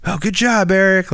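high, sing-song praise voice; mock praise. Imitating people praising him, high and sing-song. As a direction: coo it like praising a child. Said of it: "like, pat on the back".

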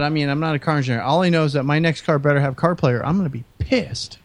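ordinary talk, nothing marked

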